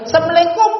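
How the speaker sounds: "Assalamualaikum" ditelan jadi "semleiku", nada jauh lebih tinggi; ejekan salam salah. Shown as a demonstration. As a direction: tirukan salam asal-asalan dengan nada mengejek. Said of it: "Bahasa apa itu?". (salam disingkat yang diejek)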